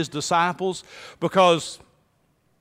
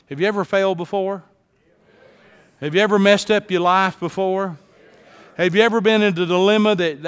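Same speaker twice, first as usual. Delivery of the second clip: ordinary talk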